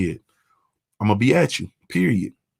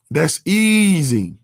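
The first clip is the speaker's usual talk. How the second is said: "That's easy" stretched out slowly; slow, savoring the point; slow, drawn-out emphasis; 4 semitones higher, much slower than usual. Real, not acted. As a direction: let "easy" hang and draw it out.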